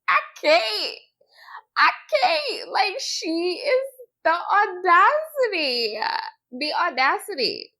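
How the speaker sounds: real laughing, high squeaky voice; cracking up. Real, not acted. Reacting to a woman's audacity, cracking up. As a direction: barely get it out through high-pitched laughter.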